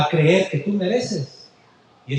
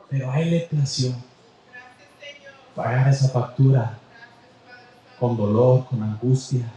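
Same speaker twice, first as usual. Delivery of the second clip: much lower, tender preaching; solemn and low; 8 semitones lower than usual. Acted. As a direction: let it sink in, low and grave. Sermon slows to deep low. (solemne y grave)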